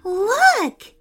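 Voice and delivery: high, loud excited call. A narrator's reading. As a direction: point it out with delight. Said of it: "said Nea excitedly".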